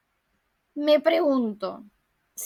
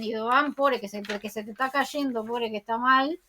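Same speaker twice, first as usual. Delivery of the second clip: ordinary delivery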